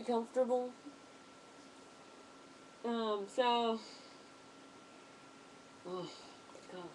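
muffled behind rubber mask; speaking into mask filter; through a gas mask. Real, not acted. Seen 1: Full gas mask covers the face throughout; removed around 8.9s.

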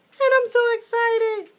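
high, loud excited squeal with a crack; squealing excitement. Real, not acted. Vlogger opening a birthday gift squeals with joy. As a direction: burst out squealing over the gift.

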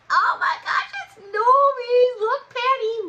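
real thrilled outburst; excited shout. Real, not acted. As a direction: shriek of surprise as the card pops open. Card pops out, Nomi.

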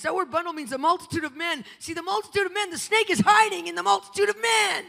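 high, loud fervent preaching; rising sermon fervour. Acted. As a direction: preach it hard to the congregation. Preaching climax.

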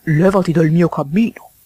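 gruff order from a toad; lightly voiced grumpy toad; grunting toad. A narrator's reading. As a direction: grunt it out at the intruder. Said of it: "grugnì il signor Jackson".

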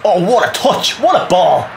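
high, loud exclamation at a great play; thrilled football fan's cry; excited praise. Real, not acted. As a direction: burst out with admiration. Cheering play during match.

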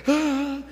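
high-pitched acted gasp; frightened gasp. Horton sees hunters' rifles pointed at him, acted fright.

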